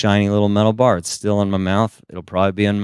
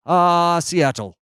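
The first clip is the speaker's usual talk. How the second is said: put-on British accent; 7 semitones higher, much slower than usual. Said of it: "You don't talk like you're from Seattle".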